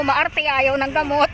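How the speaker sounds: ordinary delivery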